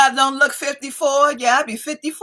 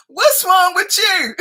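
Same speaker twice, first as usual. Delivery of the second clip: laughing husband; 7 semitones higher, slower than usual. Retold or imitated. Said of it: "he was like".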